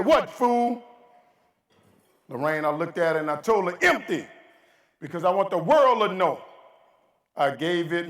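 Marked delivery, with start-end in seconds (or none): 0.0-0.8 high, loud "What, fool?"; wife cutting off her husband's speech; mock exasperated wife. 3.8-8.1 rousing punchline. Acted. Punchline of speech.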